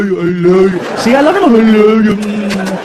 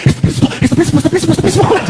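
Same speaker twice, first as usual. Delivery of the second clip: rising, more panicked each time; kid's climbing panic; panicky kid; 8 semitones higher, much faster than usual. Retold or imitated. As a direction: repeat it getting higher and more frantic. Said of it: "'cause he's like".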